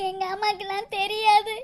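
low, soft sobbing reply; acted crying. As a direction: break into quiet sobs while answering. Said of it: "சரி, சரி, அழுகாதடி". (விம்மி அழுதபடி தாழ்ந்த குரலில்)

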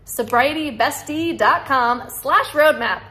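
each part of "Sobrietybestie dot com slash roadmap" said slowly and deliberately; slow, clear spelling-out. Shown as a demonstration. Vlogger repeating her web address so viewers catch it, slow and clear. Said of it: "repeated slowly".

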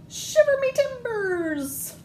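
very high, slow squeaky pirate exclaim; little pirates. Acted. Little pirates answering mommy in the bath, very high slow exclaim. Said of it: "say the little pirates".

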